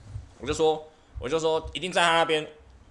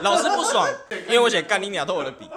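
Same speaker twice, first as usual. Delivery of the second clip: laughing; 5 semitones higher, 6 dB louder than usual. Real, not acted. Laughing recalling teacher's anger.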